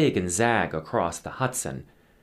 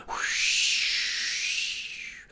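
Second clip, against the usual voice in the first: long, airy rushing whoosh; long wind whoosh. A narrator's reading. Sloop catching the wind, long whoosh. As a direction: blow it out like wind. Narrator imitates wind in sails.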